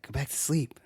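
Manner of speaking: whispered, soft and eerie; a creepy girlfriend whispering from the dark corner. Retold or imitated. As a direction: breathe it out like a creepy night visitor.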